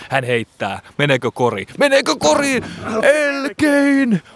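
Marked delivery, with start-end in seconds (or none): 1.8-2.6 higher, tense repeat of Meneekö kori; excited. Shown as a demonstration. Commentator repeats the question, high and tense. 3.0-4.2 high, tense question then a cry; breathless suspense. Pretend. Commentator asks if it goes in, high and tense.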